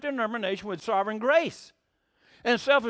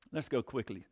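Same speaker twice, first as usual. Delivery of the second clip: ordinary talk, nothing marked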